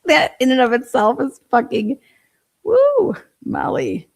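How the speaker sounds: high, loud woo of disbelief; a loud, high-pitched woo about Molly's marathon shift; exasperated outburst. Real, not acted. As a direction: let it burst out, way up high.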